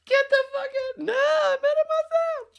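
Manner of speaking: squealing, very high movie-comedy voice; loud falsetto film impression, wildly incredulous; Bronson Pinchot. Quoting Beverly Hills Cop, shrieking impression. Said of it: "Bronson Show and Eddie".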